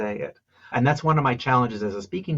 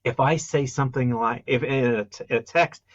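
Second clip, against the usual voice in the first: normal talking voice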